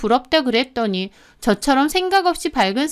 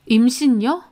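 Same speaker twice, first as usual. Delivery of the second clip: ordinary delivery, nothing marked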